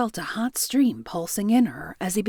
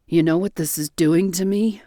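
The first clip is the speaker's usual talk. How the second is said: groaning; 2 semitones lower, 4 dB louder than usual. A narrator's reading. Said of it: "Joe groaned".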